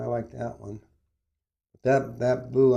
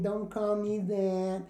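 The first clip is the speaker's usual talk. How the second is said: slow, higher, mocking hurt feelings; mock offended whine; 6 semitones higher, 3 dB quieter, slower than usual. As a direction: parody a touchy complainer, slowly. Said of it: "Now everybody's so offended by everything".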